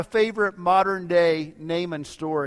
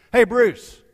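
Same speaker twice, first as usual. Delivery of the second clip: loud, high hail; big man's booming, raised-pitch call; 5 semitones higher, 11 dB louder than usual. Acted. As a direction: shout to someone across a parking lot. Big man calls across parking lot.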